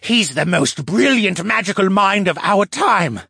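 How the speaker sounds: high, loud devotion; fervent. A narrator's reading. Captive merman praising his leader, fervent. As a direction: proclaim it, voice lifted and forceful.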